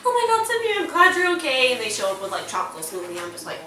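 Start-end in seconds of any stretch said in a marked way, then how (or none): 0.0-1.7 high, gushing mock relief; overwrought relieved mom. Mom greets daughter at the hospital, frantically relieved. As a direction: imitate a frantic mom bursting in.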